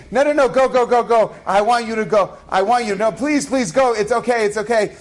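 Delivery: high imitation of a pleading wife; mock pleading reassurance, high and repetitive; hammed-up insistence. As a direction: urge him on, pitched well up. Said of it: "But she's really saying".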